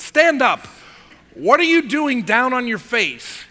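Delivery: high, forceful acted command; stern rebuke. Preacher as God scolds Joshua lying face down, stern rebuke. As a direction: order him up, loud and exasperated.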